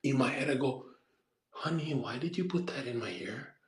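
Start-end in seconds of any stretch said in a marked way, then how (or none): 1.5-3.5 hushed inner voice. Retold or imitated. Inner voice, said in his head.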